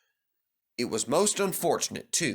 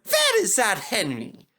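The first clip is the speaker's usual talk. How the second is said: impatient engine; 8 semitones higher than usual. Acted. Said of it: "said Peter Sam".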